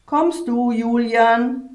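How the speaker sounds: loud, high call up the stairs; calling upstairs. A narrator's reading. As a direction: call out to someone on the floor above. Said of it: "rief sie die Treppe hinauf".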